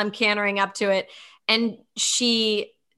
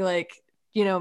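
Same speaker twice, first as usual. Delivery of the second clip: ordinary talk, nothing marked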